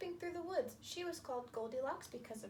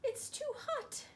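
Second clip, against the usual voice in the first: bears complaining; 12 semitones higher, 7 dB louder than usual. Acted. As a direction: pitch it way up and draw it out. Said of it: "and said".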